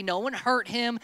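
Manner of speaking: usual speaking voice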